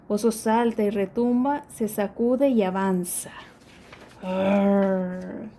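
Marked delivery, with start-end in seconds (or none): 4.2-5.5 gruñido grave y sostenido de oso; un rugido grave de oso despierto. Acted. El oso gruñe. (el oso gruñe, grave)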